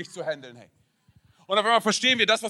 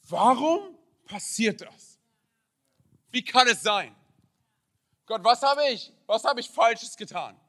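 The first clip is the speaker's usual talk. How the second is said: anguished raised-voice questions, drawn out; crying out to God; 5 semitones higher, 9 dB louder, slower than usual. Acted. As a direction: call out your desperate why to God. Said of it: "fängst du an zu schreien und zu rufen". (verzweifelt zu Gott rufen)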